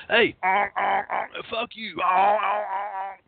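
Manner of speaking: high, mocking yell of an angry driver. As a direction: ape the raging guy in the car. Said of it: "He goes".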